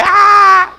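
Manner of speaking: high, loud scared yelp; mock scream. Preacher mimics a man screaming in a movie, high loud yelp. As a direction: yelp like a man grabbing an arm in fright. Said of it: "grab another man's arm and say".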